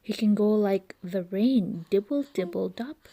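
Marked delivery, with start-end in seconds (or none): none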